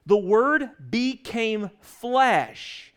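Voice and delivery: very slow, every word of "The Word became flesh" stretched; preacher lingering over verse fourteen; drawn-out scripture. Shown as a demonstration. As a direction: savour each word, very slowly. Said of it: "it says".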